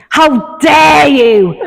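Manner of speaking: hammed-up outraged mum, cracking up; mock stern mum voice. Mum scolding her son on the path, mock outrage. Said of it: "mum voice".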